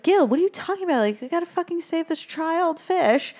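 high-pitched incredulous pleading; mock-frantic protest, pitched well up; urgent protest. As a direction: imitate an alarmed crowd objecting. Other fish protest to Gil, acted.

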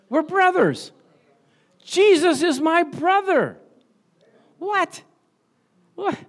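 thrilled, high pitched wonder; joyful amazement. Real, not acted. Pastor announcing to the church, high with delight.

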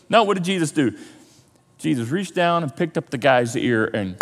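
normal talking voice

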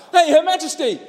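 homeless man shouting. Retold or imitated. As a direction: yell at the Queen for attention. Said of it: "man starts shouting out".

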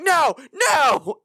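loud high cry of no; panicked shout. Retold or imitated. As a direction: yell it in sudden dismay. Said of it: "but you're like".

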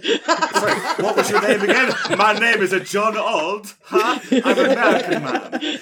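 mock American man. Mock John Old introducing himself, laughing. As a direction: ham up a dumb American guy.

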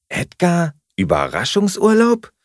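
high-pitched, sceptical echo; incredulous question. A narrator's reading. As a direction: question it in sheer disbelief. Flatmate doubts the surprise trip. (ungläubig und hoch nachgefragt)

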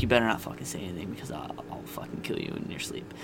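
quick, hushed threat that drops to a whisper; imitating Stacy's whispered threat; mock threat. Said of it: "Stacy's like".

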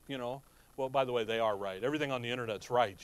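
low, quiet deadpan mock agreement; sarcastic mutter under the breath; sarcasm. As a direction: say it dry, clearly not meaning it. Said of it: "that's sarcasm".

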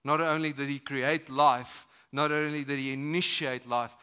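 normal talking voice

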